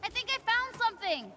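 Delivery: high, eager shout to a companion; excited call-out. Acted. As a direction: call out a find, pitched high. Calls out discovery to companion.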